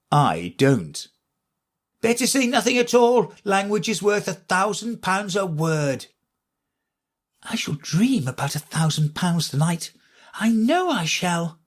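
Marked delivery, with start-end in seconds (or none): 2.0-6.1 chorus of voices. A narrator's reading. Chorus thinks in chorus. 7.4-11.6 put-on high dreamy little girl; Alice musing; young girl voice. A narrator's reading. Alice thinks to herself.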